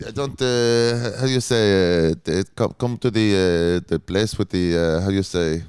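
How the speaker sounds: put-on halting Israeli accent; slow, groping for words in an Israeli accent; an Israeli accent, searching for the English word; Esti. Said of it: "can you do Esti from the Comedy Cellar?".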